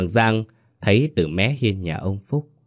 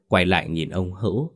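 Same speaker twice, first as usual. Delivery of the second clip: usual speaking voice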